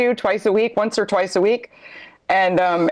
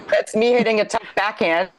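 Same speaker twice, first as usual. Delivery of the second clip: ordinary talk, nothing marked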